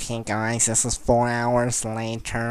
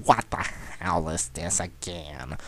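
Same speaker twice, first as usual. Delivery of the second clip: ordinary delivery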